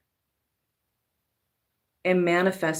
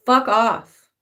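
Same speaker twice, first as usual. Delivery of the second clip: defiant retort; 3 semitones higher, 6 dB louder than usual. Acted. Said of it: "And says".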